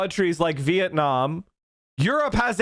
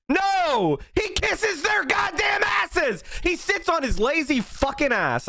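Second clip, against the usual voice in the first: much higher, furious shout, slowing on the insult; yelling; 9 semitones higher than usual. Real, not acted. Streamer raging at Biden before his chat, furious yelling. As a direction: explode in real anger. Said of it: "and I'm yelling".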